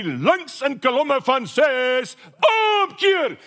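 slow drawn-out Afrikaans parade command rising to a high shouted omkeer; imitating RSM drill command. Preacher imitating the RSM shouting orders to six hundred troops, mock drill yell. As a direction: bark the drill order like a grumpy sergeant major, final word yelled high. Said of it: "He used to go something like this".

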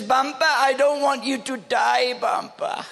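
put-on high tearful child's wail, breaking off; crying child. Grandfather voicing granddaughter begging him not to die. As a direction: cry it out like a scared little girl.

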